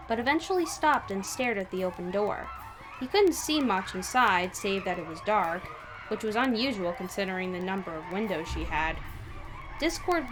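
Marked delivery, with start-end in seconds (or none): none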